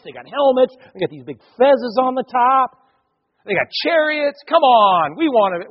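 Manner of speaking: high, excited clamouring; eager pleading. Acted. People demanding a king like others, high excited pleading. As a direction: pitch it up and plead with growing impatience.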